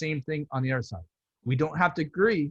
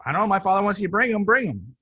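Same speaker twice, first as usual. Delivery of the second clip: quick, higher, louder imitation of wife; retold brisk urging; 5 semitones higher, 4 dB louder, faster than usual. Wife telling her husband to bring the books, brisk urging. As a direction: retell her hurried go-ahead, voice lifted.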